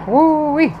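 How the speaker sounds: high, loud woo-wee; amazed whoop. Real, not acted. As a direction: whoop at the downpour. Marvels at heavy rain.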